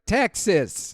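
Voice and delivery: bad Texas accent. Co-host imitates Nicolas Cage arriving on set, bad Texas accent. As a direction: put on a clumsy Texas accent. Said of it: "with a bad Texas accent".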